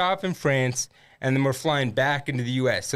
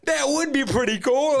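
much higher, imitating a keen friend; mock eager agreement; 9 semitones higher than usual. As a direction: jump on the silly idea, pitched way up. Friend eagerly joins joking plan.

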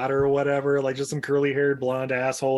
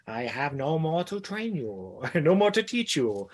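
wise master voice; 2 semitones higher than usual. Retold or imitated. Voicing Bruce Lee's ghost.

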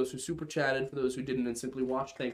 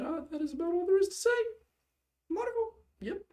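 high sing-song; faster than usual. Acted.

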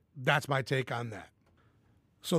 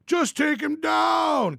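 slow, much higher mock drunk shout; drunk casual; 11 semitones higher, 4 dB louder, much slower than usual. Mocking drunk bar casuals calling out to the fighters, slow hammed-up yell. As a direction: drag it out like a sloppy drunk yelling advice. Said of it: "drunk casuals spouting off their genius hot takes".

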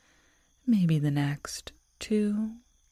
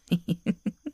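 rising playful giggle; teasing laugh; 2 semitones higher than usual. Acted. Werewolf girl teasing her lover, rising playful giggle. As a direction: giggle like you caught him out.

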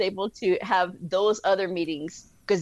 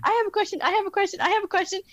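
higher, eager, repeating the plea; bright mock eagerness, much higher; eager hands up; 13 semitones higher, much faster than usual. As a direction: mimic a crowd keen to ask. Many eager people asking questions.

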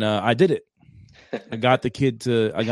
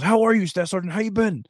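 much higher, overly polite greeting; imitating eager junior Marine; 9 semitones higher than usual. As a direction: play the cheerful subordinate. Imitating Barden greeting him politely.

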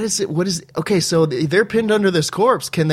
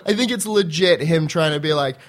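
usual speaking voice

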